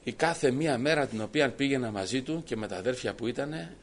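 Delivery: ordinary talk, nothing marked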